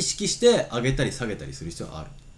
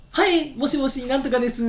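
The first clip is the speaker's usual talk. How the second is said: much higher, louder phone greeting; 14 semitones higher, 5 dB louder than usual. Retold or imitated. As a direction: answer the phone like a mother. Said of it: "いきなり高い声になるじゃん".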